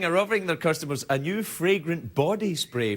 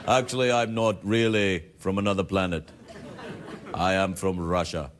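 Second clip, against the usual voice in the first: monkey king puppet, low confiding aside; Kronos; 12 semitones lower, 4 dB quieter than usual. Acted. Monkey king admits where he is from, low accented deadpan. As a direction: drop low and confess sheepishly in an accent.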